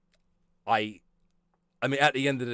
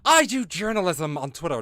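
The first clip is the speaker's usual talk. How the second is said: mock journalist; 6 semitones higher, 5 dB louder than usual. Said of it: "It's like".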